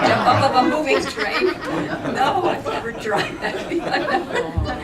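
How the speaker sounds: a retold protest, half laughed; laughing retort. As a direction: giggle out an incredulous answer. Retelling her laughing reply.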